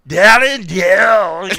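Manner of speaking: drunken mock Irish slur on Darren Till; slurred Irish-ish accent. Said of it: "Because you did it in an accent".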